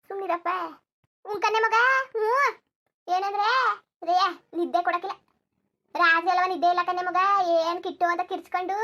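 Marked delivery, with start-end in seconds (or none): 1.3-8.8 loud weary lament. Acted. To her daughter about the sleepless baby, loud weary wail. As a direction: cry out your exhaustion, voice strained. Exhausted mother wails to daughter.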